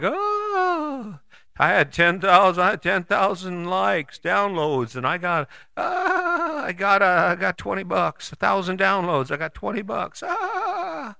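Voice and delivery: high, whiny voice boasting about downloads; mocking social media user. Mocking an influencer who earned twenty bucks, whiny high voice. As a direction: play a small-time influencer bragging, then let down. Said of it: "and you're like".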